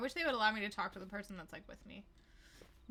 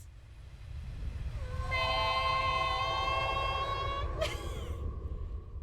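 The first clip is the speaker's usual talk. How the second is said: long high-pitched fit of laughter; 4 dB louder than usual. Real, not acted. Streamer cracking up at the earthquake sound, long laugh. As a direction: keep laughing at the silly noise.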